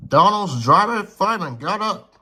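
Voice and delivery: usual speaking voice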